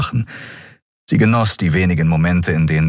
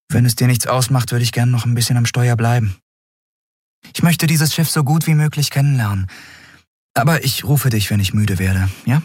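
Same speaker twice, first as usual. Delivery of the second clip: put-on higher, lighter young woman's voice, asking politely; Endriel, higher voice; 6 semitones higher than usual. A narrator's reading. Endriel speaks to Kero. (Endriel bittet Kero höflich, mit höherer Stimme)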